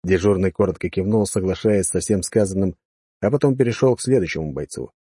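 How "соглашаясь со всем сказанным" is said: plain reading voice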